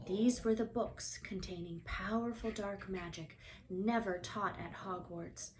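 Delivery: ordinary delivery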